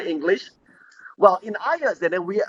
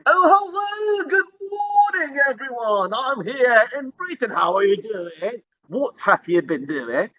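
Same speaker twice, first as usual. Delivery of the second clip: mock British accent; 6 semitones higher than usual. Shown as a demonstration. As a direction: open with a high, hammed-up British hello. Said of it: "okay, like British".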